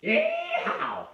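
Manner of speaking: high, loud Texan yeehaw; cowboy whoop. Pretend. Cowboy whoop after mentioning Texas.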